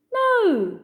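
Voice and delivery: a small panicked squeak. A narrator's reading. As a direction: mouse protests over the crowd. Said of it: "Pip squeaked".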